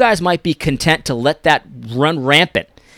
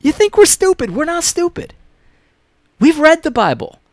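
exasperated shout; 13 semitones higher than usual. Real, not acted. Exasperated rant at critics.

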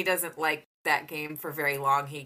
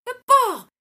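put-on high, little-kid voice; voicing a small child; toddler voice; 9 dB louder than usual. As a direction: imitate a toddler. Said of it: "he kept going".

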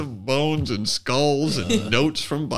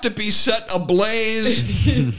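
mock aggrieved wife; 9 semitones higher, 4 dB louder than usual. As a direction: drag it out like a martyr. Mocking validated wives.

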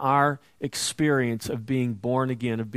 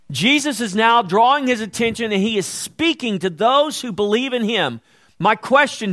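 raised, louder preaching voice, pushing each phrase; fervent preaching; 8 semitones higher, 7 dB louder than usual. Acted. Preacher urging believers, in full-force sermon voice. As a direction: preach it out to the congregation with fire.

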